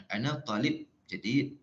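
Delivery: "Ana talib jadid" in quick, even Malay intonation; Malay-accented Arabic. Shown as a demonstration. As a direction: keep "talib" short and unstressed, Malay-style Arabic.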